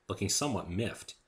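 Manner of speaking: plain reading voice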